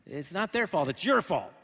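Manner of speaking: loud accusing jab, pointing the finger; mock blaming. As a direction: throw the blame back, loud and sharp. Blamer voiced, louder.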